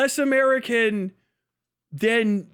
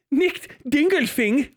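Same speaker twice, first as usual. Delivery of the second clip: mock German dismay; 4 semitones higher, slower than usual.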